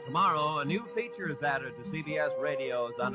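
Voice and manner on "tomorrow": ordinary delivery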